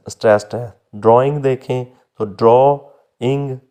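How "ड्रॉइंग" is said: Drawing split as draw, pause, ing; first syllable stressed. Shown as a demonstration. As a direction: lean on "draw" in drawing, then a separate "ing". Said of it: "पहला है जो वो stressed". (Drawing को तोड़कर पहले हिस्से पर ज़ोर)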